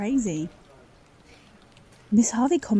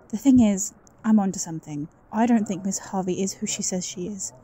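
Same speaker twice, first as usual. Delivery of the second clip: ordinary talk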